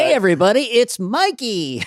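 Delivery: hammed-up kids' show greeting, pitched high; Nickelodeon show voice. Comic mocking a sitcom-style name, hammed-up show intro. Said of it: "it sounds like a Nickelodeon show".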